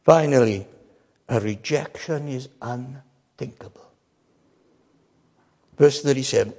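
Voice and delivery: ordinary talk, nothing marked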